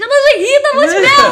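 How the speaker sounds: high, loud laughing punchline. Real, not acted. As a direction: crack up on the punchline. (rindo alto no desfecho)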